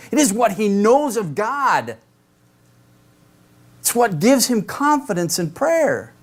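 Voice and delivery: high-pitched, ringing sermon voice; impassioned preaching. Acted. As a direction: drive each point home from the pulpit, pitched high throughout. Preaches at raised intensity.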